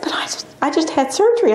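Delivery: high, breathless disbelieving whisper; patient protesting in a hushed voice; retold shocked whisper. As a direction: whisper it in alarm.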